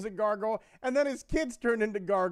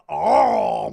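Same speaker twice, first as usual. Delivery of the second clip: loud far-off monster groan; a sudden growling monster call from under the bed; 13 dB louder than usual. Acted. Under-bed monster lunging at the kid, loud groan.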